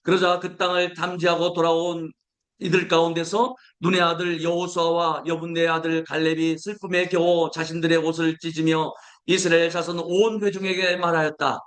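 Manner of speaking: normal talking voice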